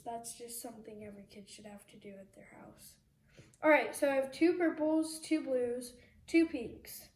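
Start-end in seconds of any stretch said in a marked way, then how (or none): 0.0-2.9 whispered aside. Real, not acted. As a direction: drop to a breathy hush for the aside.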